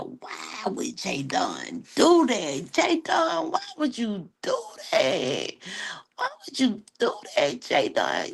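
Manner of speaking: high, weepy mock whine with a cry on the words; hammed-up hurt crying. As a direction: pretend to be wounded and whine up high. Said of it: "I be getting my little feeling hurt".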